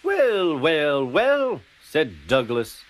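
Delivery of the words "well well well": high, falling, knowing teasing; mock surprise. A narrator's reading. Douglas feigns surprise at James.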